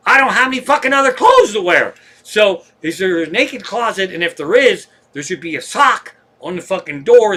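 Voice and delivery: loud, high outburst of comic exasperation; exasperated protest. Retold or imitated. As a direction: burst out in indignant protest, then stay loud. Retold comic protest about clothes.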